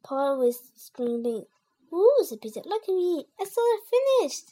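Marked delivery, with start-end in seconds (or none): 1.9-2.2 high, loud ooh; rising-pitched delighted exclamation; surprised. A narrator's reading. Peter reacts to plate. 2.6-4.5 high, loud proud announcement; excited child, voice pitched up; showing off. A narrator's reading. Peter shows off his plate.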